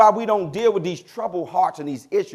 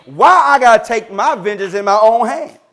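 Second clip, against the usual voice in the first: 1 rising preaching shout; 3 semitones higher, 7 dB louder than usual. Real, not acted. Preacher builds to loud question.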